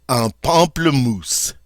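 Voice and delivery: "pamplemousse" as pahm-pluh-MOOSE, slow; slow, relished French "pamplemousse"; French pronunciation. Shown as a demonstration. Teacher savouring "un pamplemousse" for the class. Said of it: "I love this word".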